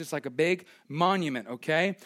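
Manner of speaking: ordinary delivery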